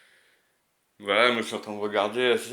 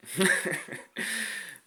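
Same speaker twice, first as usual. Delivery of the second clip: high laugh; 10 semitones higher than usual. Real, not acted. Laughs at own crude remark.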